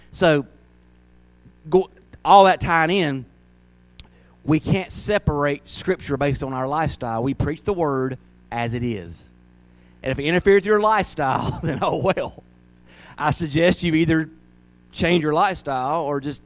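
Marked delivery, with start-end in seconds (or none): none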